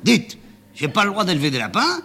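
le chauffeur s'emporte, voix plus aiguë; râler contre son patron en s'échauffant; colère montante. Acted. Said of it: "se mettre en colère tout seul". (le chauffeur se met en colère tout seul, voix montée)

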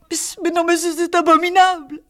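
voix qui monte très haut, bouleversée; cri d'angoisse. Acted. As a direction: crier son désarroi, la voix qui se brise. Dispute, elle apprend la rupture. (une femme blessée crie à son mari, voix très aiguë)

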